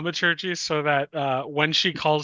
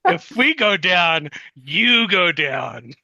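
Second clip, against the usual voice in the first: loud, slow, deliberate threat; weighty warning, each word drawn out; intimidating threat; 3 semitones higher, 5 dB louder, slower than usual. Acted. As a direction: spell the threat out slowly and forcefully. Player threatens character to intimidate him.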